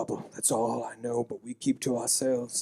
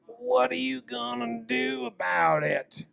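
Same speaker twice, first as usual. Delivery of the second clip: a hint of a slow, higher tough guy; tough challenge; 9 semitones higher than usual. Restaurant tough to players, lightly voiced slow challenge. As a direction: dare them to try something.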